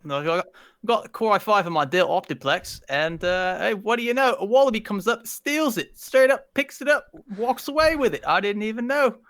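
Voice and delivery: high-pitched mock Aussie storyteller; hammed-up Australian accent. Shown as a demonstration. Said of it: "give us the accent and a story combined".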